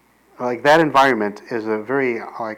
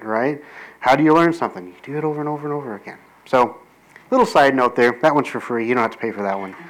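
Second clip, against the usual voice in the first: ordinary delivery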